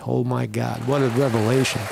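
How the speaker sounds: normal talking voice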